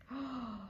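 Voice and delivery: quick quiet intake of breath; a small hushed gasp of surprise; soft gasp. Acted. Narrator teasing listeners about candy, soft gasp. Shocked at what she bought.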